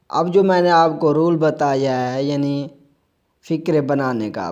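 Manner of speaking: ordinary talk, nothing marked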